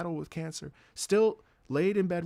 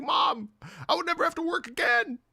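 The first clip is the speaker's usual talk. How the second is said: high-pitched acted plea; hammed-up desperate protest; exasperated pleading; 13 semitones higher, 3 dB louder than usual. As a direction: whine it up high as if begging mom. Acts out pleading with mom.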